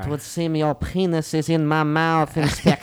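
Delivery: cartoon boy genius accent; Dexter impression. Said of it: "He kinda sounds like Dexter from Dexter's Laboratory".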